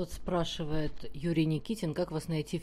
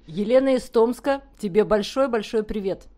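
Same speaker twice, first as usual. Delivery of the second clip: big loud shout-out greeting; 5 semitones higher, 12 dB louder than usual. Real, not acted. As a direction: greet her loudly and happily.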